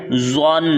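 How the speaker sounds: زن with thick emphatic ظ; heavy, full-mouthed z in زن. Shown as a demonstration. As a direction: say زن as a rounded "zwan". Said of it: "پر پڑھنا ہے موٹا".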